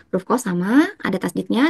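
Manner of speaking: ordinary talk, nothing marked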